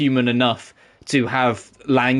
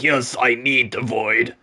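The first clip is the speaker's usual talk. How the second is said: villain voice; slower than usual. Retold or imitated. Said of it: "Meanwhile, X-Death's like".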